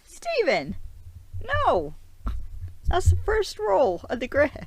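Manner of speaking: high, loud cry of dismay; mock scolding. Vlogger scolding Stephen for blowing his cover, loud mock dismay. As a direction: scold in exaggerated, high-pitched exasperation.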